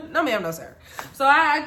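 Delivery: ordinary talk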